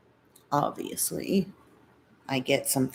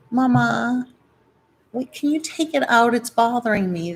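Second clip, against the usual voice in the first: higher pretend voice of her dog; dog's voice; 6 semitones higher, 4 dB louder than usual. Owner voicing her dog asking to remove barrettes, higher pleading voice.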